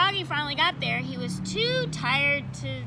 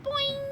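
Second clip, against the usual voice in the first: boing. Acted. Bouncing sound effect.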